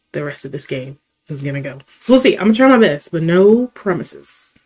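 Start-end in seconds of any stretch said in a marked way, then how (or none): 0.1-1.8 fast and softer, under his breath; quick mutter. Real, not acted. Repeats his own line.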